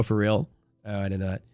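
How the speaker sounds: usual speaking voice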